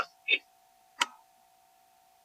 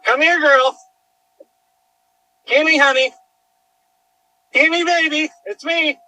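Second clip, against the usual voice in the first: coaxing call to dog; 10 semitones higher than usual. Real, not acted. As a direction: lure the dog in with a bright, high call.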